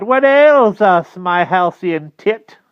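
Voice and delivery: loud, high, drawn-out witch voice; witch's cry. A narrator's reading. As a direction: stretch it out as a crying witch. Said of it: "she cried".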